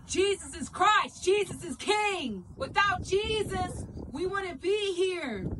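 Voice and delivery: drawn-out, high-pitched shout; loud and proud. Shown as a demonstration. Street preacher making a scene for Jesus in a store, loud and proud. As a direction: proclaim it at full voice, stretching every key word. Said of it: "say it loud and proud".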